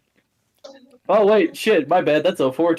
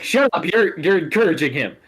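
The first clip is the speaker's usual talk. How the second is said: higher, louder exasperated retort; Mizu hissing; 5 semitones higher, 4 dB louder than usual. Retold or imitated. Mizu scolding Kyo for laughing, higher and louder. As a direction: tell her off, half annoyed. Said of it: "She's like".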